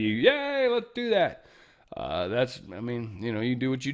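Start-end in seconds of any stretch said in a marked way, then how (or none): 0.0-1.4 very high put-on cheering; hammed-up eager yay; mock cheer. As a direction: squeal it like a gullible crowd.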